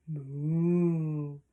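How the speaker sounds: long, full, sustained moo; cow moo. Acted. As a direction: imitate a cow, holding the moo. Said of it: "A cow says".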